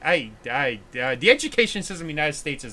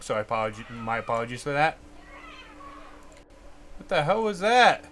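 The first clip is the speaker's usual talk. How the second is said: ordinary talk, nothing marked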